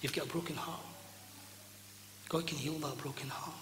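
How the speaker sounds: soft, low preacher's voice; soft ministering. Acted. Preacher offering healing to the broken-hearted, soft ministering. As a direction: speak gently and quietly to the hurting.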